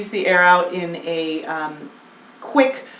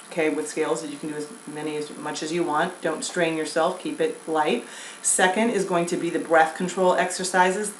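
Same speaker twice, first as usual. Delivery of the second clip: normal talking voice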